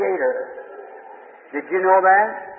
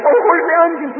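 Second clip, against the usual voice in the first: sudden high, louder yelp of surprise; startled cry; 11 semitones higher, 4 dB louder than usual. Retold or imitated. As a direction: jump as the grease pops. Acting startled by popping grease.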